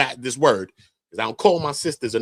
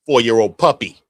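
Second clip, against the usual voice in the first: loud exclamation; 8 dB louder than usual. Real, not acted. Ranting commentator, sudden loud.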